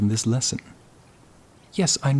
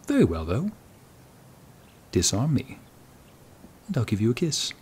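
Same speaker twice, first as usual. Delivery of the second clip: ordinary delivery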